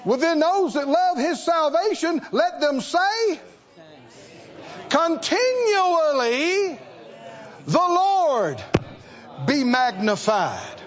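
high, ringing preacher's call to the congregation; preaching at full force. Acted. Preacher urging the church to magnify the Lord, full force. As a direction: lift it up and preach it out.

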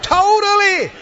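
high-pitched, ringing declarations to a congregation; impassioned preaching. Real, not acted. Preacher declaring the dead are free in heaven, impassioned preaching. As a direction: proclaim it in short, rising bursts of triumph.

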